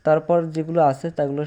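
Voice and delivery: ordinary talk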